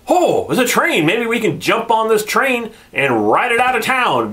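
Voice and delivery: high, sing-song mock delight; mocking characters. Reviewer mocks the characters' train plan, high sarcastic voice. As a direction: parody their dumb idea in a silly high voice. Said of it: "and they're like".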